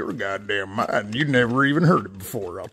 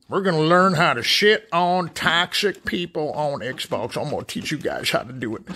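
ordinary talk, nothing marked

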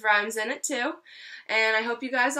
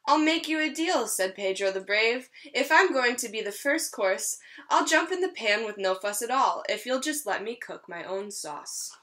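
lively storybook read-aloud; reading to children. Acted. Teacher reading a rhyming story to her class, lively. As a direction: read it like a picture book for a class. Reads picture book to children.

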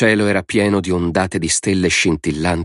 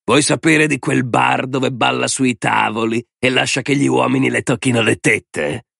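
raised, needling sneer; insinuating taunt; 5 semitones higher than usual. A narrator's reading. Man taunts child about mother's bar, sneering. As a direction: needle the child with nasty gossip.

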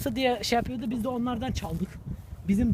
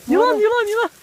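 alarmed shout; 7 semitones higher, 6 dB louder than usual. Real, not acted. Spotting a snake on trail.

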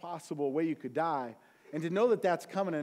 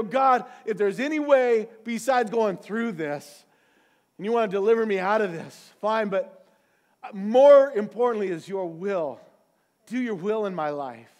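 pleading prayer; 3 semitones higher, 7 dB louder than usual. Retold or imitated. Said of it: "break down and go".

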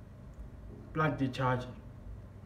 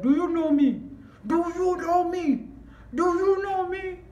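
loud shout climbing to a very high screech; escalating angry challenge; 13 semitones higher, 5 dB louder than usual. Retold or imitated. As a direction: repeat it higher each time, stretching it out. Retold quarrel, escalating threat to cashiers.

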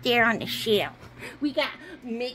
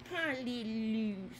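usual speaking voice

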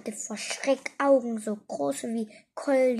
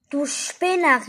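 loud, raised scolding call; calling after someone; 4 semitones higher, 6 dB louder than usual. A narrator's reading. Igitte scolds departing Ette, calling after him. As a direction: shout it after him, half exasperated. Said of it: "rief Igitte ihm nach".

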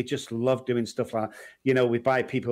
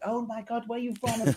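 much higher, thrilled American voice; gushing American fan; 10 semitones higher than usual. Retold or imitated. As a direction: play an American gushing over an English accent. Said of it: "They go".

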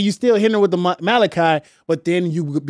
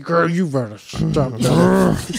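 slow, gritty mock scolding; slower than usual. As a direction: drag it out like a sassy teacher. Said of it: "you gotta hit it with the grit".

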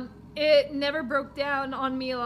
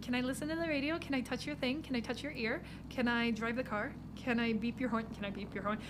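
much softer teasing questions; mumbled requests; 3 semitones lower, 10 dB quieter, faster than usual. Real, not acted. Player pestering the driver, much softer. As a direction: murmur the silly requests quietly. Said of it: "mumbled silly repeated questions".

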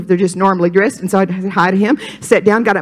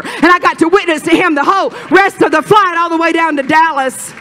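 high, driving preacher's testimony; fervent preaching; 7 semitones higher, 3 dB louder than usual. Real, not acted. Preacher testifying to a stranger on a plane, fervent preaching. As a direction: preach it at a high pitch without letting up.

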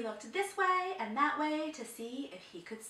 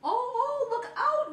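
high laughing whoop; whooping chimp; 9 dB louder than usual. A narrator's reading. Chimpanzee warning the elephant, high whooping laugh. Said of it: "whooped the chimpanzee".

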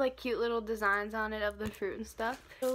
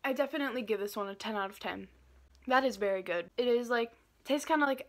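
eating a chocolate bite; talking while chewing; 2 semitones lower, faster than usual. Real, not acted. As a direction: mouth partly full of chocolate. Seen 3: Bites chocolate before the words, bites again at 2.3 s.

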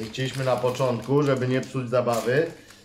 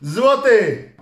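loud, high reveal; excited reveal; 8 semitones higher, 9 dB louder than usual. Acted. Parent revealing a gold card, excited. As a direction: announce it with a flourish.

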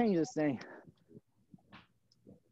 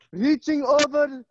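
higher put-on coaching voice; 6 semitones higher than usual. Coach cueing stretches to the class, put-on voice. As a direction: call the move in a raised, affected voice. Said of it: "But I talk like this all the time".

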